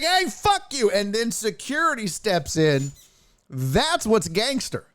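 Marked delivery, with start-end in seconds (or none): 0.0-0.9 high-pitched mock heckle; imitated yell. Streamer acting out Mac turning back on Philly fans, mock yell. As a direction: toss it back high, like a retort. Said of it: "He's like".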